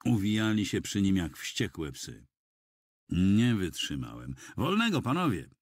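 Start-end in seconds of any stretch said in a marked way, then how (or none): 4.6-5.5 higher-pitched call to hold back. A narrator's reading. Bystander who can stand it no longer to militiamen, calling out. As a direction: raise the voice in protest. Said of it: "zawołałem".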